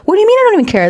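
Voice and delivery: mock-offended husband, pitched high. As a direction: play the hurt husband, voice pushed way up. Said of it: "That would, like, offend my husband".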